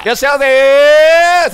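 high, slow, loud welcome call; bright loud shop welcome. Pretend. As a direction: sing out the welcome to the store. Shopkeeper calling out welcome.

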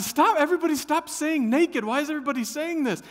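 loud high flustered plea, fast at the end; exasperated plea. Retold or imitated. Dad to his family in the van, exasperated and loud. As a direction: beg them all to stop.

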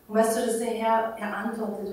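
ordinary talk, nothing marked

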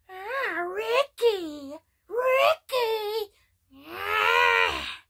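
high, loud whining cry of a name; whiny wail. Retold or imitated. [name] teasing [name] with Conky, high whining wail.

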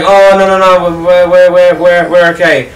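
mock-panicked no thanks; flustered England. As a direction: stammer a nervous brush-off as England. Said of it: "And England was like".

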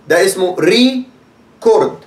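ريكورد said slowly with ري stretched; slow, first-syllable stress on ريكورد. Shown as a demonstration. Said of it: "يبقى أنا دوست على دي".

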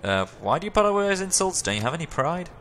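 high, disbelieving question; incredulous. Real, not acted. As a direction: push back in disbelief. Player challenging, high pitch.

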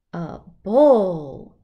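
loud announcement of the bull; big bull. Acted. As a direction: boom out the bull. Bull introduced.

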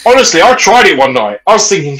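normal talking voice

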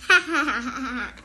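loud burst of laughter; hearty laugh. Acted. Narrator laughing along with the crowd at the joke. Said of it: "Everybody laughed and laughed".

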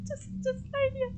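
whimpering panic. Real, not acted. Crying panic.

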